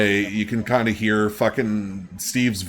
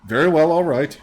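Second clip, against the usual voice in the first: lightly voiced character, higher pitched; non-player character; 7 semitones higher than usual. Game master answers as a character, lightly higher-voiced. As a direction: let "well" lift in a lightly acted reply.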